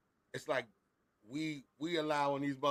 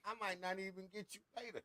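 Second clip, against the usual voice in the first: mom's mind games; 4 semitones higher, 8 dB quieter than usual. Retold or imitated. Mom warning her child of punishment, softly menacing. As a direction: draw the threat out softly, in no hurry. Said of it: "The mind games that my mom played with me".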